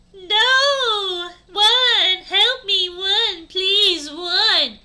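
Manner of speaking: high, very loud cries for help; terrified scream. Acted. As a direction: scream and plead in hammed-up terror. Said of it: "Brittany screamed terrifiedly".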